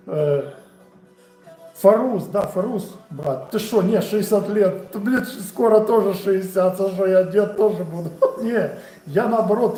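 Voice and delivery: usual speaking voice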